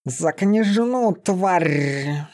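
slow, high growled retort; drawn-out menacing snarl; furious beast-man. A narrator's reading. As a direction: growl it out, rolling the final r. Beast-man answers the sentry angrily.

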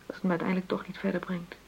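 hushed resignation; despairing whisper. Acted. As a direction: let it fall out tired and hopeless. Bitter quiet despair.

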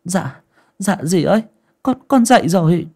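timid startled reply. A narrator's reading. Little Động startled awake, answering her stepmother timidly. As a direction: answer fast, scared of her.

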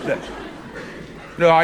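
normal talking voice

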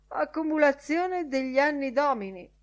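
comic groaning. A narrator's reading. Lenihan rubbing his sore knee, comic groaning. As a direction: moan it with mock suffering. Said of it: "Fece una buffa smorfia e gemette".

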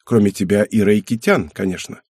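ordinary delivery, nothing marked

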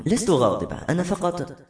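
hesitant denial, left unfinished; mildly flustered, trailing into silence; faltering. A narrator's reading. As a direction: start to explain and falter. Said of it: "قالت بتلعثم".

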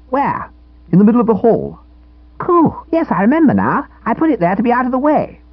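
high, eager schoolboy voice; cheeky boy scout. A narrator's reading. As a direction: play the smug little scout, pleased with himself. Narrator voices Edwin.

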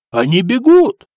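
high, loud jubilant cry; excited shout. A narrator's reading. Yusuf repeating that pirates flee, excited shout. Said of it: "прокричал Юсуф".

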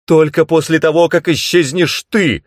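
high, loud and insistent; insistent shout. A narrator's reading. Tananda insists Skeeve vanish first, loud insistence. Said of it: "настаивала она".

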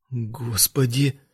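slow, soft desperate prayer; praying. A narrator's reading. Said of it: "взмолился он".

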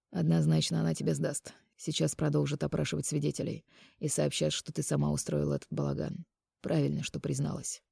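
soft, restrained questioning; disappointed Mark, quiet. A narrator's reading. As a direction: press her quietly, without force. Narrator voices Mark in dialogue. (тихо и сдержанно, с разочарованием)

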